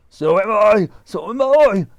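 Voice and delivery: husband agreeing he feels giddy; crying out. Acted. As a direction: cry out in fear. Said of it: "cried Mister Twit".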